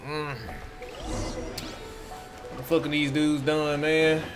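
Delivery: ordinary talk, nothing marked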